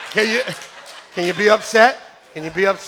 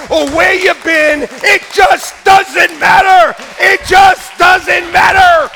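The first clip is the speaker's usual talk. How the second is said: jubilant preaching; 6 semitones higher, 8 dB louder than usual. Real, not acted. Preacher celebrating that everyone who met Jesus is happy, jubilant shout. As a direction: shout it out in full triumphant force. Climax of the enacted meeting with Jesus.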